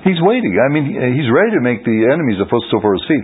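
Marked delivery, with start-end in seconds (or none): 0.1-0.5 emphatic high repeat. Real, not acted. Teacher to class, repeating that God waits, higher falling exclaim. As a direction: hammer it home from the top of the voice.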